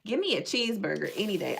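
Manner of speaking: ordinary talk, nothing marked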